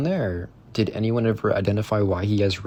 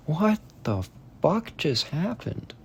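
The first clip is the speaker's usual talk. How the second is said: slow, higher, baffled; stunned disbelief; 7 semitones higher, 5 dB quieter, slower than usual. Real, not acted. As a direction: let it hang in disbelief. Reacting to weird goat behavior.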